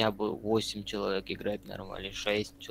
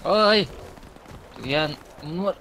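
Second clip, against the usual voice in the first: exasperated; 10 semitones higher, 9 dB louder than usual. Real, not acted. Frustrated exclamation in game.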